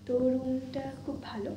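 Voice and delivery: soft night whisper; breathy hush so nobody wakes. Real, not acted. Quiet hotel room at night. (ফিসফিস করে)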